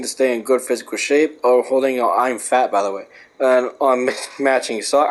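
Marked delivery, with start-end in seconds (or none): none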